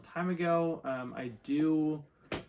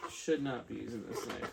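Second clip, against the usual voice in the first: ordinary delivery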